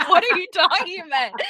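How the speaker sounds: high, loud mock disbelief; laughing bafflement. Husband baffled by his wife, mock disbelief.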